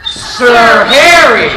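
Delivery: loud, drawn-out exclamation; triumphant guess. Acted. Guesser solves the mimed clue of which knight, triumphant. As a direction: stretch both words and call them out.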